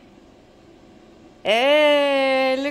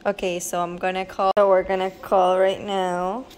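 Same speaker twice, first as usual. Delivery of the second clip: usual speaking voice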